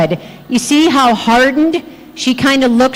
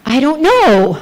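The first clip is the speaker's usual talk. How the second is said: evasive patient; 2 semitones higher than usual. Retold or imitated. Patient dodging a question about her gout, evasive. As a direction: act out a reluctant non-answer to the ceiling. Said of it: "that's the way she said it".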